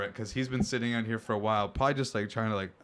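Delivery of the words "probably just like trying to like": ordinary delivery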